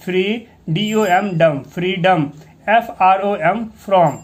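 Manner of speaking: ordinary delivery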